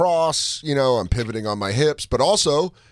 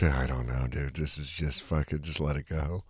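low dismissive mumble; 9 semitones lower than usual. Retold or imitated. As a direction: mumble it like you don't care. [name] shrugs it off.

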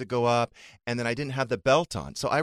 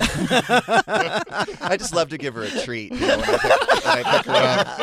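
high, loud, breaking into laughter; cracking up mid-sentence at her own gag; laughing through the joke; 10 semitones higher, 6 dB louder, slower than usual. Real, not acted. Mom laughing as she jokes about picking her daughter up, laughing loudly. Joking about buying cigarettes.

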